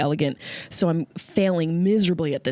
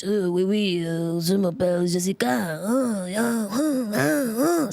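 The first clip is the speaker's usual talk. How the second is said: hammed-up raspy French accent; sneering at a French speaker in a mock French accent; slower than usual. Mocking someone who brags about French, in a raspy mock French accent. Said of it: "Don't come at me with your French".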